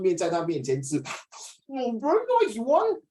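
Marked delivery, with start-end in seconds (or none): 1.7-3.0 high, drawn-out mock delight; slow, high-pitched teasing relish; gloating relish. Acted eater relishing food before fasting friend.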